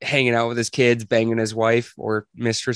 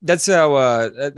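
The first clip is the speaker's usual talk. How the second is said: ordinary talk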